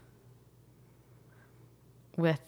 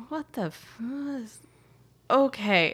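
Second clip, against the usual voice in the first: a hint of a baffled Lewis; 6 semitones higher than usual. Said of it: "look at him like".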